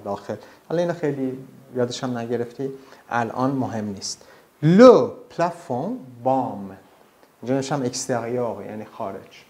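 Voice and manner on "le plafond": Le plafond said very slowly and louder, nasal fond; Le plafond spoken very slowly; slow French model. Shown as a demonstration.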